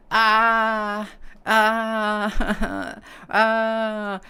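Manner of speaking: high, loud drawn-out "ah" wails; hammed-up freak-out crying; mock tantrum wail. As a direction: imitate a floor-thrashing tantrum. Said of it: "he's on the floor throwing a tantrum".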